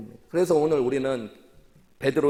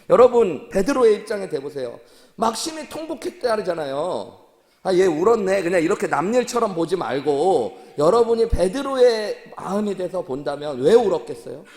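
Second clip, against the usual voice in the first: higher, louder pleading sermon voice; impassioned preaching; 5 semitones higher, 6 dB louder than usual. Acted. Pastor urging the congregation to feel Peter's grief, impassioned and loud.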